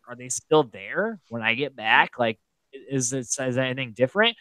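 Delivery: a soft worried inner voice; quiet doubtful musing. Retold or imitated. Houseguest wondering if the partner is still there, softly. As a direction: voice the doubt gently to yourself.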